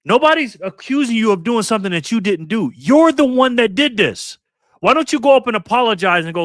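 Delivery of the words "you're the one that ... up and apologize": angry accusation. Real, not acted. Accusing coach angrily.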